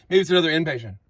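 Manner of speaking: normal talking voice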